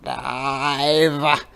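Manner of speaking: slow, lightly croaky frog; lightly voiced frog. A narrator's reading. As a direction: say it calmly as a frog. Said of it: "said Frog".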